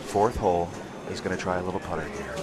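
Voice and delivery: hushed golf announcer; soft, low golf commentary; golf commentator hush. Shown as a demonstration. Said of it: "like golf clap style".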